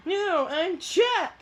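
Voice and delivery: high, slow insisting voice; Chuck's voice. Acted. Chuck correcting his friend again, high and slow. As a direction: pitch way up and drag it out.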